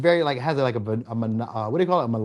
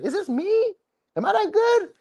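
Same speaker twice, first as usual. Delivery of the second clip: much higher, giddy voice of someone dazzled by the sex; flattered disbelief. Retold or imitated. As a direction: play the lover who can't believe how good it was. Mimicking a flattered lover.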